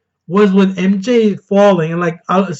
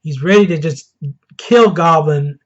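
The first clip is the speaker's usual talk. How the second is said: normal talking voice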